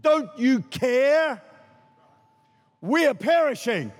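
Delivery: slow pleading cry; disciples pleading. Retold or imitated. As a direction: drag out the plea to Jesus. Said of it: "what the disciples literally said".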